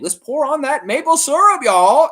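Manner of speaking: high, loud hype yell; rally the viewers with a loud, high cheer; excited shout. Real, not acted. Streamer hyping his chat over Canada's big lead, loud and high.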